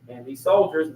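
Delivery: ordinary talk, nothing marked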